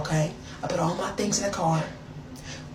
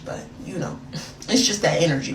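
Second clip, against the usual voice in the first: ordinary talk, nothing marked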